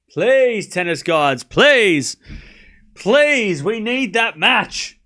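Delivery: high, loud plea to the tennis gods; pleading prayer. Pretend. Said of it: "I'm praying".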